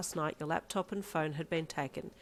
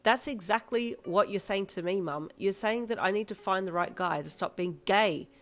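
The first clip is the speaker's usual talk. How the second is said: louder, defiant confrontation; raised, heated defiance; angry outburst; 4 semitones higher, 6 dB louder than usual. Acted. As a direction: stand up to her mother. Daughter confronts mum angrily.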